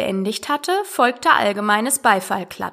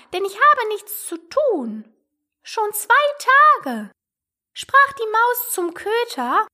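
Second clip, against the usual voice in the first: very high mouse voice, declaiming verse; little mouse reciting; 12 semitones higher than usual. Acted. Mouse reciting its long tale to Alice, high-pitched. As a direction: recite rhythmically in a squeaky mouse register.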